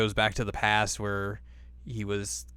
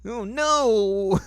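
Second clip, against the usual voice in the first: much higher, mock-bashful girly voice; embarrassed girl; 14 semitones higher, 4 dB louder, slower than usual. Recapping the anime to a friend, Kagome mocked in a much higher bashful voice. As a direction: squeak it out like a flustered schoolgirl. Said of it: "And she's like".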